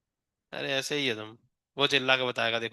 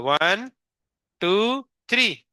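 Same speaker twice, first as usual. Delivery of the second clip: 1 drawn-out count; 3 semitones higher, much slower than usual. Real, not acted. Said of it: "हम three तक count करेंगे".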